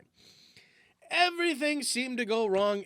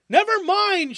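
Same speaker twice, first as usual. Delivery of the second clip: very high, loud shout; 12 semitones higher, 9 dB louder than usual. Acted. F shouting to panicked Jones. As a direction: call it across the field. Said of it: "shouted F".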